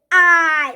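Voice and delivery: loud open "Ah" from the throat; loud throaty "Ah" demonstration; demo vowel. Shown as a demonstration. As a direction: belt out the "Ah" sound. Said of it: "put your voice back of your throat".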